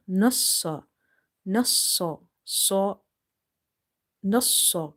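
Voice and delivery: nassa with swad said twice; doubled letter. Shown as a demonstration. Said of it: "one swad we are reading with the noon".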